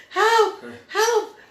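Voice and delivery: very high, loud mock screams; imitating screaming daughter. Mom mimicking daughter's cries while dragged across yard, screaming. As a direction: shriek it comically, on the edge of laughter. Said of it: "my daughter was screaming".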